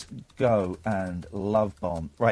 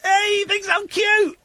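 very high thrilled squeal; delighted squeal. Real, not acted. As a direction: squeak it out with glee. Delighted at being called cute.

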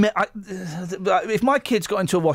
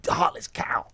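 under-the-breath whisper; whispered insult; 3 semitones lower, 4 dB quieter, slower than usual. Real, not acted. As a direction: hiss it under your breath. Muttered insult at mother.

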